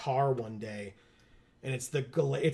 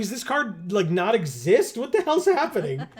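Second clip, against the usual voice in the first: high-pitched, louder mock exasperation; incredulous; 7 semitones higher, 5 dB louder than usual. Player retelling a failed card search, incredulous. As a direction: build to a baffled, hammed-up outburst.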